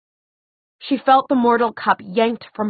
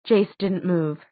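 usual speaking voice

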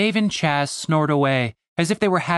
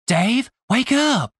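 high, slow wake-up call; 9 semitones higher, slower than usual. Acted. Stacy calls slowly and high to wake Dave up. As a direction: call him awake, drawing it out.

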